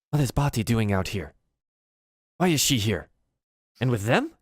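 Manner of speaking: a hint of a goblin questioning a friend; goblin onlooker. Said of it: "says something in goblin to his friend".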